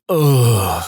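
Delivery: drawn-out mock "ugh" groan; imitated groan. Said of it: "sometimes he'd let out an".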